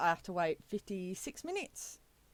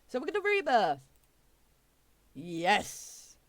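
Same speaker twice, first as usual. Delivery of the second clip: excited; 2 semitones higher, 5 dB louder than usual. Real, not acted. Triumphant rebirth moment.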